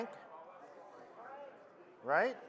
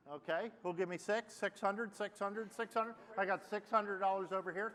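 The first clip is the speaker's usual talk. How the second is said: auctioneer; 9 dB quieter than usual. Acted. Auctioneer bid calling.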